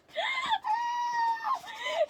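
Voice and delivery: high screaming laugh of joy; scream-laugh with delight; shrieking laugh. Real, not acted. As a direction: ecstatic squealing cackle. Finally got her bias.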